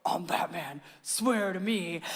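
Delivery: a hint of Batman, dropped low. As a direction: kid imitating Batman at home. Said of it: "they have this little voice".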